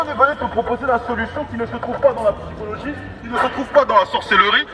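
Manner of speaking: ordinary delivery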